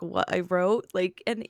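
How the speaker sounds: usual speaking voice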